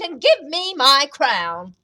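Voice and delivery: loud, drawn-out demand; slow, forceful storybook order; demanding elephant. A narrator's reading.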